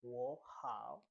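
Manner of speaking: WO HOW with HOW dipping then rising; third tone. Shown as a demonstration. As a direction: let HOW fall and climb after WO. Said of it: "the third one".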